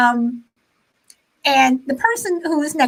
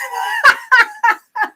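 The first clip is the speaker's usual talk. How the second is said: high squealing laugh; 12 semitones higher, 5 dB louder than usual. Real, not acted. Reacting to Shelby's comment.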